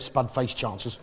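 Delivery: ordinary delivery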